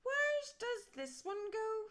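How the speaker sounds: high, loud and slow, puzzled; drawn-out wondering bones; big skeleton voice. A narrator's reading. Big skeleton puzzles over the bones, high and slow. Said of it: "said the big skeleton".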